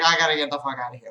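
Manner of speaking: fast, low mumbled aside; dog's thought, fast. Pretend. Voicing the dog's wish to flee, fast and low. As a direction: rush it out like fleeing. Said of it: "she's like".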